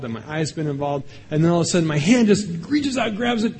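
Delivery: ordinary delivery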